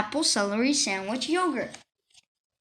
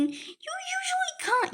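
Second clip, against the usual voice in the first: squeaky interruption; 7 dB quieter, slower than usual. Acted. Kid voice cutting in on narration.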